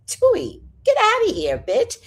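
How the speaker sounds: high, loud snippy cat voice; voicing her cat. Cat telling its owner off, high and snippy. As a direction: give the cat a sassy, high brush-off. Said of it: "And he's like".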